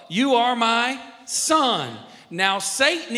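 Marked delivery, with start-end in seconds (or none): 0.1-2.0 loud, high, very slow affirmation; God the Father's declaration. Acted. God the Father affirming his son, loud slow declaration. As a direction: state it slowly and with full certainty. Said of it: "God the Father said what?".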